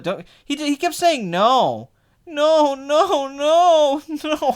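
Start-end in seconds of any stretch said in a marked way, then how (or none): 1.3-4.5 high mock wailing, breaking into a laugh; hammed-up cries of denial; very upset moviegoer. Mimicking the guy who kept saying no, upset. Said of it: "he was very upset".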